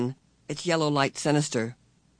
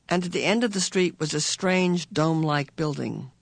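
ordinary delivery, nothing marked

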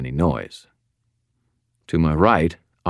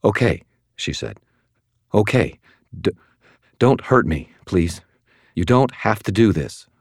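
frightened; 4 semitones higher than usual. Acted. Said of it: "visibly trembling".

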